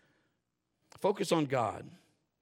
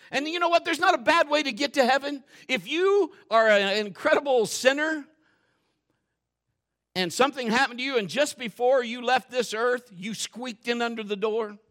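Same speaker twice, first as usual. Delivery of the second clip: louder, higher fiery preaching; full-force preaching; 8 semitones higher, 9 dB louder than usual. Acted. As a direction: bring it home to the congregation.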